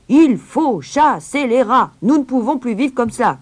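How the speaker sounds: loud, high-pitched angry outcry; crowd shouting. A narrator's reading. Townspeople of Hamelin protest about the rats, crying out. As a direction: cry out as fed-up townspeople. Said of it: "criaient-ils".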